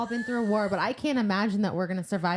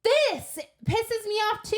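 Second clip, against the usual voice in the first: high, slow exasperated line; 11 semitones higher, slower than usual. Real, not acted. Reader fed up with Feyre, slow exasperated. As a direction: let the annoyance rise.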